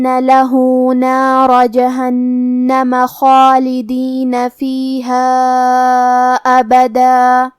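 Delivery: slow, chanted tajweed recitation; Quran recitation. Shown as a demonstration. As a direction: draw the verses out in melodic recitation. Repeats the verses in recitation. (تجوید کے ساتھ تلاوت)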